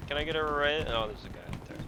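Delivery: normal talking voice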